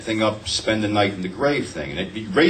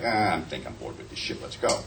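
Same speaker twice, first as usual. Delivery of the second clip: low, soft, fed-up mumble; bored; 11 semitones lower, 9 dB quieter than usual. Retold or imitated. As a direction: let it sag, too bored to care. Retold boredom at the movies.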